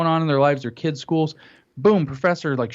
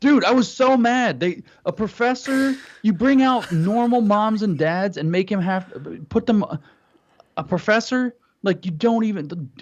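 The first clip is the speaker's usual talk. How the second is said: raised pitch, heated rant; indignant, pitched up and incredulous; worked-up indignation; 5 semitones higher than usual. Real, not acted. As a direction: vent it in exasperated disbelief. Still indignant, recounting the unfair panel.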